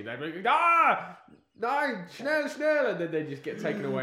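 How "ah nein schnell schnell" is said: loud, higher barked German commands; movie villain german accent. Retold or imitated. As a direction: bark orders like a war film villain. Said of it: "full Nazi".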